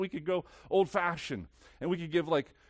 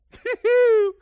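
incredulous laugh; 14 dB louder than usual. Acted. Jonah reacts to message.

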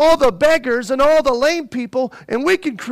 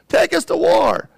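demanding crowd; 8 semitones higher, 4 dB louder than usual. Retold or imitated.

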